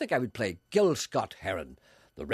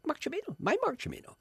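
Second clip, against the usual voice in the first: high, fast, breathless whispering; excited whisper; faster than usual. Retold or imitated. Telling himself about his idol on TV, a high excited whisper. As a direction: whisper it fast, barely containing the thrill. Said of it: "I said".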